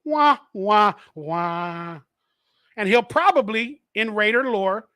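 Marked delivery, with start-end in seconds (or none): 0.0-2.0 mock "wah, wah, wah", last one drawn out and falling; sad trombone imitation. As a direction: vocalise a losing-game trombone sting. Mocking sad trombone after bad pick.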